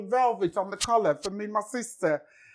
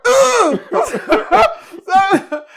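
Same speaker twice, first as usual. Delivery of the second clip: laughing; 6 semitones higher, 10 dB louder, much slower than usual. Real, not acted. Laughing at the question.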